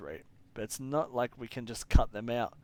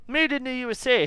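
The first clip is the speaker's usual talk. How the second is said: put-on "Made" punched high and loud in a strange made-up accent; odd mystery accent; 13 semitones higher, 10 dB louder than usual. Voicing the engineer's discovery, loud odd accent. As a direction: belt out "Made in the U S A" in a vague put-on accent, higher and louder. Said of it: "I don't know what the fuck that accent is".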